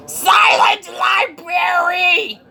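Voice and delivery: very high, long-drawn shriek; screamed library warning. Acted. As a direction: scream it slowly at full pitch. Mock librarian screaming for silence.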